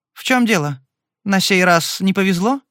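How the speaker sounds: coolly polite, slightly mocking question; polite inquiry. A narrator's reading. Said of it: "вежливо осведомилась она". (вежливо-холодный вопрос, голос выше)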